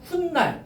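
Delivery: 훗날 as hun-nal, double n; demonstrated 훗날. Shown as a demonstration. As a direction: hold the linked n in 훗날. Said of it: "이렇게 발음되고요". ("훈날"로 니은을 살려서)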